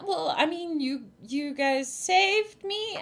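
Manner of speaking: halting and lifted in pitch; hesitant, puzzled. Acted. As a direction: drag it upward, unsure. Game master acts puzzled rescued girl.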